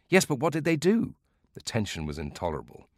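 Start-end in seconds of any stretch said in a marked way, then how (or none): 0.1-1.1 much higher, eager urging; can't wait to hear the end; young listener begging the old man to get on; impatient. Acted.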